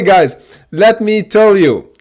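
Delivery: ordinary delivery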